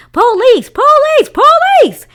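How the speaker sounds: shrill, very high squawking cry of a parrot; parrot shouting. Acted. The parrot Petra squawks an alarm at the intruder, screeching. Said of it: "She started shouting".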